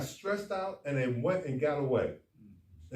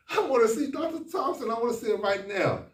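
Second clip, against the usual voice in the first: high, loud mock demand; a hint of a pushy man barging in; imitating a man demanding the doctor; 6 semitones higher, 4 dB louder than usual. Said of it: "I couldn't get my staff stop from stop laughing".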